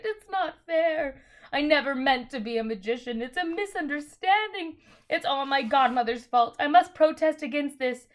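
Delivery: high, loud crying lament; wailing. A narrator's reading. Uncle Andrew complaining in the dark, wailing. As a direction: whine and sob in self-pity. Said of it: "it was wailing on".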